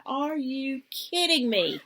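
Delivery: slow, drawn-out mock disbelief; exasperated disbelief. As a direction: stretch it out in mock outrage. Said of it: "And I'm like".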